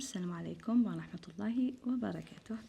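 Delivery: soft unvoiced whispered blessing; whispered greeting. Real, not acted. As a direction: breathe the opening words in a hush. (salutation chuchotée)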